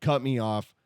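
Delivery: ordinary talk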